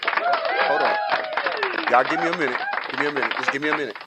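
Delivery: real emotion cracking through the words; voice breaking. Real, not acted. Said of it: "I hate when I be getting emotional".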